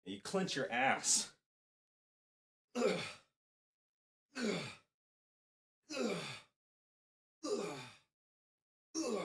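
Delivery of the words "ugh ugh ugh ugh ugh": effort grunts. Real, not acted. Grunting while swinging kettlebell.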